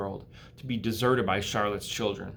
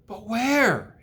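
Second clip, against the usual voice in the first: small, high spider voice; lightly voiced baby spider; spider child voice; 7 semitones higher, 5 dB louder, slower than usual. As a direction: pipe up like a tiny spider.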